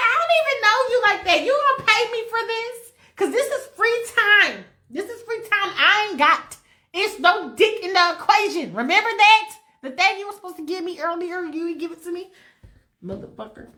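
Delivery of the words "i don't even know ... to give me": higher, quicker, sassy [name] clapback. Retold or imitated. [name] snapping at Michael, higher and sassy. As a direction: toss it off like an annoyed diva. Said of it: "And she's like".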